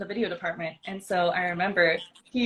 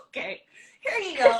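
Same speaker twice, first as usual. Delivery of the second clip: a quick laughing stab at Swedish, much higher and louder; mock Swedish accent; 10 semitones higher, 4 dB louder than usual. Shown as a demonstration. Teaching Bryce a Swedish accent on the spot, half-laughing. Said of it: "teach Bryce a Swedish accent".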